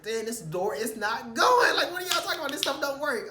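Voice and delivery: high, loud exasperated yell with a laugh; laughing, shouting at a stuck door; frustrated shout. Retold or imitated. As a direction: blow up at the door that won't open.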